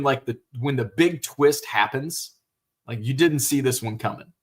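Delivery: ordinary delivery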